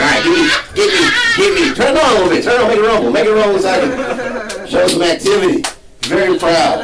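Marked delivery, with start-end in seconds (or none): 0.0-5.6 high, fast giddy voice; giddy excited squeal. Retold or imitated. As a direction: egg the machine on, giddy and high. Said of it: "I was like".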